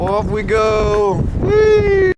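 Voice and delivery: slow singsong then a high squeal; playing airplane with the bird; airplane spoon game. Flying the bird like a toy.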